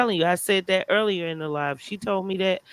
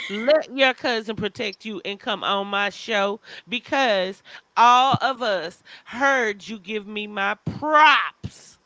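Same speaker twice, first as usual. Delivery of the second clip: ordinary delivery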